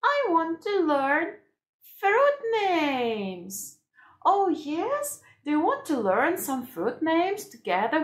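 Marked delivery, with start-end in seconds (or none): 0.0-3.8 high-pitched pretend baby voice; a little one asking eagerly, pitched up; baby Grace. Baby answers her carer, wanting to learn, high voice. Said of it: "baby Grace?".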